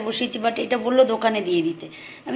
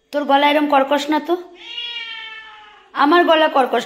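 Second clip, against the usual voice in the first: high, loud fussing over the pet; 5 semitones higher, 5 dB louder than usual. Real, not acted. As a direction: fuss over the pet like a worried mother. Talking to pet about throat.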